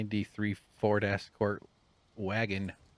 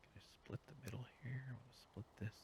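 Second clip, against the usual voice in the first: 1 whisper; 5 semitones higher, 18 dB quieter, faster than usual. Real, not acted. Whispering while building.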